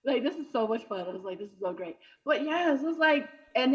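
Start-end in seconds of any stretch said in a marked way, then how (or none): 1.4-2.0 sarcastic mutter. Acted.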